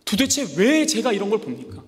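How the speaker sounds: the prophet complaining aloud to God, high and loud; Habakkuk's complaint. Acted. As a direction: cry out in frustration at God. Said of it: "하박국 선지자가 이렇게 물어보는 거예요".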